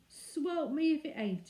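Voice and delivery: high, slow mock exclamation; cried out. A narrator's reading. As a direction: ham up the false astonishment. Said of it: "he cried".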